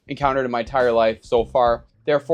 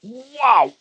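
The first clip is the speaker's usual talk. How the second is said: stunned wow; 9 semitones higher, 3 dB louder than usual. Real, not acted. First taste of scorching sauce.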